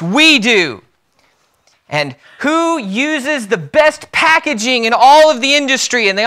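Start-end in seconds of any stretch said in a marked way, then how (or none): 0.0-0.8 mock crowd cheer, high and loud; crowd crying out. Salesmen answer their CEO, mock loud cry. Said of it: "they all cried out in one voice". 2.4-6.1 acted pep-rally question, high and loud; rallying CEO. CEO to eager salesmen, high, loud rallying voice. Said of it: "the C E O stood up before the eager salesmen".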